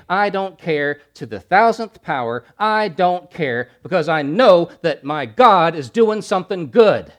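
loud, climbing sermon shout; thundering preacher's crescendo; full-force preaching. Acted. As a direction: preach it with everything you have.

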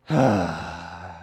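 long, audible breathy sigh; deep sigh. Acted. Preacher acts Jesus before healing the man, deep sigh. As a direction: let out a deep, drawn-out sigh. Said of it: "And then with a deep sigh".

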